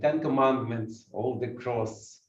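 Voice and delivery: normal talking voice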